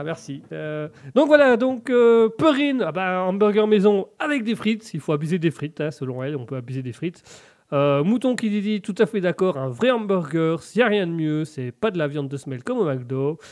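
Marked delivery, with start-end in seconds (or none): none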